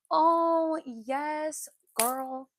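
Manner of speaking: high, loud eager yes; excited. Real, not acted. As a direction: jump in eagerly. Excited reply to viewer.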